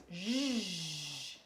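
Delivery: J as a very long "zh" buzz; consonant sound. Shown as a demonstration. Teacher sounding J while writing it, J held very long. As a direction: stretch J slowly into a sustained "zh". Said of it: "Je prononce les sons".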